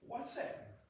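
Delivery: high, unvoiced chicken peep; curious chicken. Preacher voicing a chicken in his illustration, high and breathless. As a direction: squeak it like a nosy hen. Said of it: "and go".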